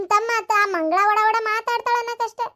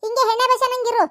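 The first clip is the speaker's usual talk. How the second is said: loud, higher sarcastic rebuke; mocking scold; 3 semitones higher, 3 dB louder than usual. Mother mocks her son's "what can I do", loud and scornful. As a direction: throw his own excuse back at him.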